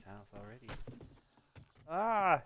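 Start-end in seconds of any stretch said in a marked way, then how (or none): 1.9-2.4 sudden high yelp; a quick startled cry. Real, not acted. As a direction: jump and yelp in fright. Startled in game.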